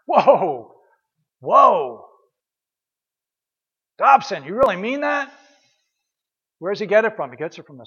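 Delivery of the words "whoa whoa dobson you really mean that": a louder, startled "whoa" with a rising disbelieving question; shocked parents. Retold or imitated. Parents stunned by Dobson's warning, voiced in startled disbelief. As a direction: play the stunned parents who can't believe it. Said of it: "They're like".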